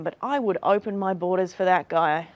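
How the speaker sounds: ordinary talk, nothing marked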